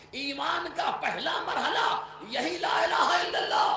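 loud, insistent pulpit cadence, the phrase repeated; forceful preaching. Acted. Preacher drilling the kalima into his listeners, loud. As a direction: hammer the refrain at the crowd.

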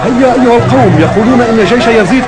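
high, loud public appeal; calling out to crowd. Acted. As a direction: rouse the crowd to flee. Agitator calls out to crowd.

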